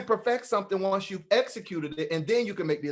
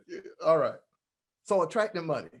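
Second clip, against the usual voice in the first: ordinary talk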